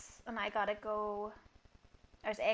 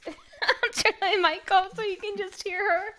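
laughing; 8 semitones higher, 5 dB louder than usual. Real, not acted. Laughs through scare.

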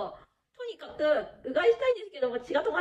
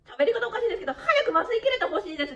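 laughing; 8 semitones higher than usual. Real, not acted.

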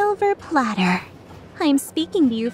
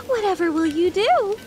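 high, playful taunt; singsong-style mock suspense; mock wondering; 7 semitones higher than usual. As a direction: tease with exaggerated curiosity, pitched up. Teasing him about his next move.

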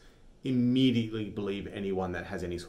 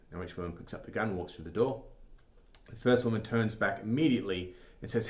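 usual speaking voice

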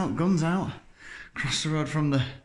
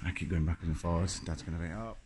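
usual speaking voice

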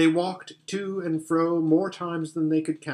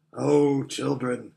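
put-on lion calling the children fondly; gentle lion voice; slower than usual. A narrator's reading. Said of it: "said the lion".

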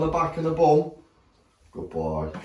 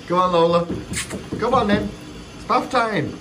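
high-pitched coaxing call; coaxing the dog; 8 semitones higher than usual. Real, not acted. Dad urging Lola to bath time, high coaxing. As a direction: coax her along, pitched up.

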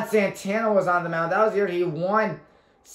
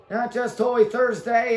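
ordinary delivery

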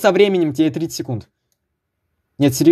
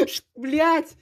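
laughing; 10 semitones higher than usual. Real, not acted. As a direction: burst of high laughter. Sudden laugh while catching Ryan.

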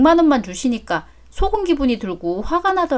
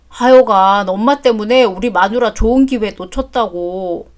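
ordinary talk

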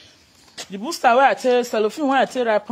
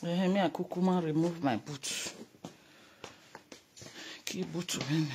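very quiet; 4 semitones lower, 14 dB quieter than usual. Real, not acted. Very quiet speech.

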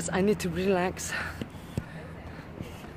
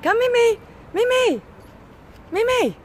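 calling the dog; 5 dB louder than usual. Real, not acted.

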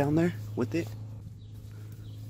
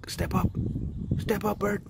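high gentle coaxing; coaxing a wild bird; 9 semitones higher than usual. Real, not acted. Coaxing the bird onto a perch.

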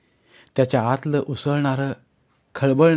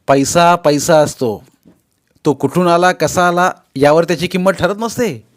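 enraged; 6 semitones higher, 8 dB louder than usual. Acted. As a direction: hammer each word out in anger. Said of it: "दादा खवळलाच". (संतापलेला मुलगा बापाला सुनावतो)

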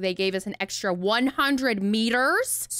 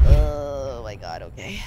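nervous groan; 8 semitones lower, 4 dB louder, slower than usual. Real, not acted. Working up nerve to enter water.

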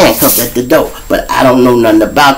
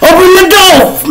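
high, loud police shout through the door; mock police yell; 4 dB louder than usual. Cops yelling for Shrimp to come out, loud and high.

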